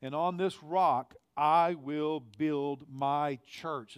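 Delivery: quoting Jesus slowly and solemnly; solemn, drawn-out reading of Jesus' words; slow scripture quote. Retold or imitated. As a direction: lay each word down like a proclamation. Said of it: "he says".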